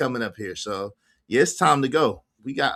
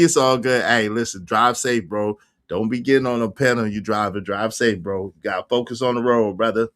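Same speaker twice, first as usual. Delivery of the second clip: ordinary talk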